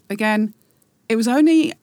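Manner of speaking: ordinary talk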